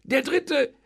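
high, lively host's pitch; eager. A narrator's reading. Innkeeper planning the wedding meal, eager. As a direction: relish each course, lifted high. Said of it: "meinte eifrig".

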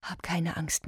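soft tender whisper. Acted. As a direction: whisper it into her ear. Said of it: "Und so flüsterte sie ihr ins Ohr".

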